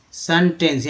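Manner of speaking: सेंटेंस said as "sun-tence"; first E as "uh". Shown as a demonstration. Teacher showing learners how E changes, सेंटेंस as "sun-tence". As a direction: open सेंटेंस with "sun", not "sen". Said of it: "ई को यहाँ पर आ बोला गया".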